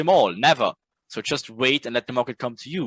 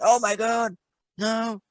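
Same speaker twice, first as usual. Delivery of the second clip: mad trader; 6 semitones higher, slower than usual. Retold or imitated. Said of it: "just be mad at everything".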